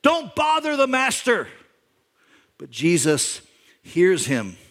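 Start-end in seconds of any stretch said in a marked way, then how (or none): 0.0-1.5 hushing crowd. Retold or imitated. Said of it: "The people around him say".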